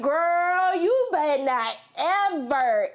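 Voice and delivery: high, slow warning to mama; playfully scolding her mother, high and slow; mock scolding. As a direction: stretch it out like a sassy warning.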